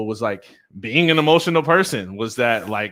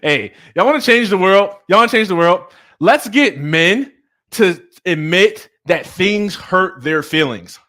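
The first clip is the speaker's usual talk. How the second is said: loud, high rallying cry; rally the crowd, stretching out the last phrase; fired-up exhortation; 7 semitones higher, 9 dB louder than usual. Real, not acted. Speaker urging men to admit hurt feelings, loud and fired up.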